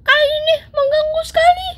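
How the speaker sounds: high put-on cartoon voice, annoyed; squeaky character complaint. Pet character grumbles at the kids, high and annoyed. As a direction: whine like a cross little pet.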